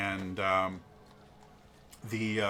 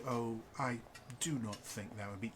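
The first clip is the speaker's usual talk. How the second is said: reserved husband; 11 dB quieter than usual. Acted. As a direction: try to object quietly.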